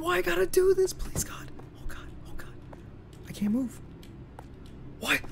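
hushed frightened whispered pleading; terrified whisper. Real, not acted. Trapped player whispering prayers in terror. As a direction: pray under your breath in fear.

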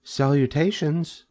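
high, thin spider greeting. A narrator's reading. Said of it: "he heard again the thin voice".

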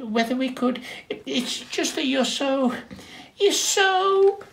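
hesitant polite call up the hill; little penguin working up courage; timid penguin. Acted. Penguin stammers a shy request.

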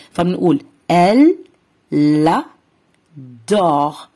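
teacher showing the linked la sound; liaison elle l'adore. Shown as a demonstration. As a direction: link "elle" into "adore" as "l'adore". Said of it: "رح تشكل صوت لا".

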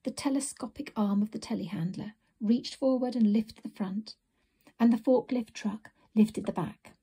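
plain reading voice

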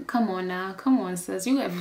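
ordinary delivery

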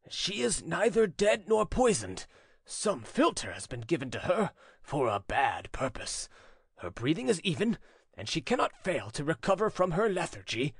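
ordinary delivery, nothing marked

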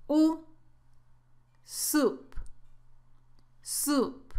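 Soup with a long OO vowel, sounded alone first; model pronunciation. Shown as a demonstration. Teacher introducing the vowel of soup, careful pronunciation. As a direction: give the OO, then say Soup with it. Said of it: "veamos la pronunciación de soup".

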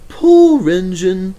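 high, slow sympathetic engine; Thomas voice. Acted. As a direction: draw out the pity in a high voice.

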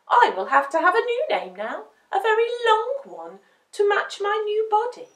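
high, loud, excited puppy voice; pleased little pup; proud puppy. A narrator's reading. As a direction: announce it with puppyish pride. Dumpling delighted with herself.

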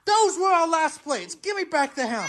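high, slowed outraged demand; furious dad wanting the hammer; angry yelling. Acted. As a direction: hold out your hand and yell for it back.

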